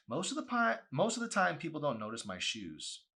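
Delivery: put-on bright high young woman voice; girlish voice.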